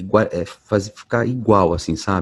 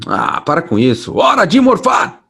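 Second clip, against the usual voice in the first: playful battle cry; 9 semitones higher, 6 dB louder than usual. Retold or imitated. Voice actor recreating the Red Ranger's transformation shout. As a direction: belt the catchphrase like a Power Ranger. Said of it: "eu dei um grito zoeira assim".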